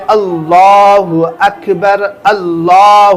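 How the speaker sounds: اللہ اکبر very slow and clear, every sound spelt out; a teacher's careful, slow اللہ اکبر; correct way. Shown as a demonstration. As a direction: say اللہ اکبر slowly and distinctly, word by word. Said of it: "کس طریقے سے بولنا ہے؟".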